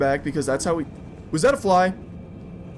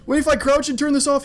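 ordinary talk, nothing marked

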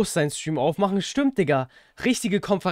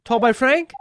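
higher, louder question; louder repeat; 7 semitones higher, 6 dB louder than usual. Real, not acted. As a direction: call it out again, louder.

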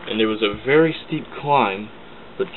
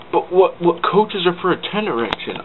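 James objecting to Sir Topham, pitched up high; indignant protest; 8 semitones higher than usual. Acted. Engine complaining to his boss, high and indignant.

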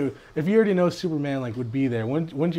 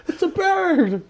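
high, drawn-out mock cry; hammed-up excited onlooker; overexcitement; 5 dB louder, slower than usual. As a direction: sing out in exaggerated amazement. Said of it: "This is an overexcitement".

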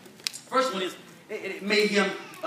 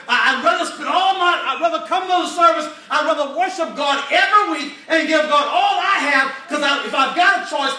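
louder, higher preaching shout; quoted preacher's shout; 4 semitones higher, 5 dB louder than usual. Retold or imitated. Retelling another preacher to the church, full shouted preaching. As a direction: belt it out like a fired-up preacher. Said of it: "As one preacher said".

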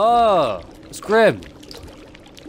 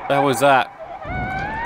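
startled; much slower than usual. Real, not acted. Sudden fright in horror game.

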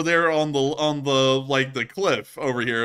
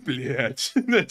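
deflated mock curse; imitated defeat. Host copying the soldier's reaction, imitated defeat. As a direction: echo it with mock resignation. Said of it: "he sounded so defeated".

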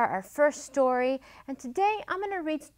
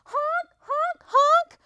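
very high, loud goose honking; goose's cry; 10 dB louder, slower than usual. A narrator's reading. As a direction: honk in alarm like a goose. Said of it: "cried Missus Goose".

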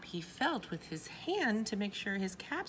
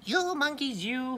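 loud, higher-pitched scolding; angry peddler; 6 semitones higher, 8 dB louder than usual. A narrator's reading. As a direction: scold the naughty monkeys loudly. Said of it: "he felt quite angry".

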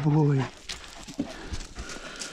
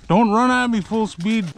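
raised, drawn-out plea to the dog; high slow call to dog; 7 semitones higher, slower than usual. Real, not acted. Owner calling his excited dog to come gently, raised and slow. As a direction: stretch the words out high so the dog slows down.

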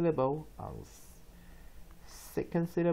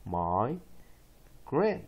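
syllable by syllable. Shown as a demonstration. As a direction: break it into "my" and "grant" for learners. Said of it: "再一遍".

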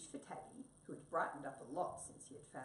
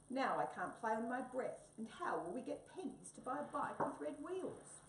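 scolding; 7 semitones higher than usual. Acted. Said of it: "scolded Wombat".

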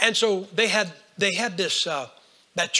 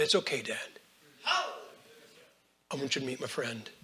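much softer, slow and hushed; tender reassurance; 5 semitones lower, 9 dB quieter, slower than usual. Acted. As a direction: gently introduce a friend to your dad, almost a hush. Jesus speaks to the Father.

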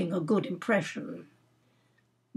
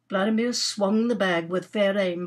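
ordinary delivery

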